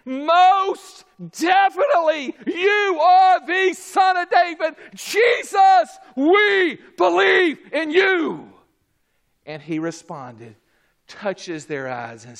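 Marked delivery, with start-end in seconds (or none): 0.1-8.5 high, slow, ringing shout; drawn-out pulpit cry of total conviction; emphatic declaration. Acted. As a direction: proclaim it at the top of your voice. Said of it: "it was an emphatic".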